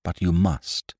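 low, serious insistence; understated solemn plea; grave. A narrator's reading. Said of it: "said the girl gravely".

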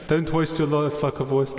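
put-on South African accent. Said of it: "the South African accent".

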